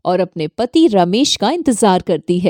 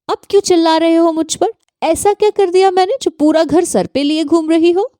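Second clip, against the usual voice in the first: higher, fast, angry comeback; indignant retort; 7 semitones higher, faster than usual. Acted. Daughter-in-law snapping back at her mother-in-law, fast and higher.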